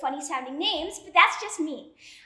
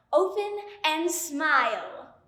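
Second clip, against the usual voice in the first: said slowly to memorize; slow repetition; much slower than usual. Acted. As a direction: spell out each word to drill it. Repeating instruction.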